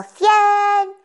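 imitating a brother finishing his count aloud; calling out. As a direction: call out the final number. Brother calls out end of count.

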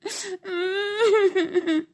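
high squeaky sobbing; mouse sobbing. Acted. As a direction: break down into little sobs. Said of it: "se le quebró la voz y se puso a llorar".

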